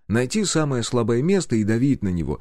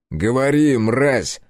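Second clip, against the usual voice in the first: louder, higher bark; barked order; 4 semitones higher, 4 dB louder, much slower than usual. A narrator's reading. Interrogator barking at the terrified girl. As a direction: bark it at her. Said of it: "рявкнул я".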